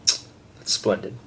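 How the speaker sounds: a breathy, slow sarcastic aside. Real, not acted. Annoyed at the light, a breathy sarcastic aside. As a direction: say it dryly, fed up. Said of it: "This light is pissing me off".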